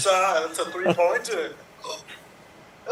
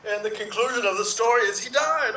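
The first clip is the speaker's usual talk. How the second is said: ordinary delivery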